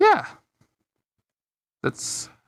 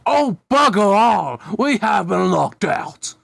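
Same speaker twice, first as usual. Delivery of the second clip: posh British exclamation; 6 semitones higher, 4 dB louder, slower than usual. Acted. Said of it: "with his eyes widen".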